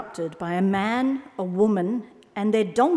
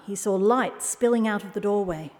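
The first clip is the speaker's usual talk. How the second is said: plain reading voice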